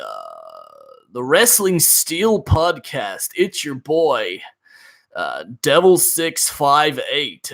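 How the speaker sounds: slow, drawn-out mock podcast host intro; weird radio voice. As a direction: parody a self-important wrestling podcaster. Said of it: "a really weird radio voice".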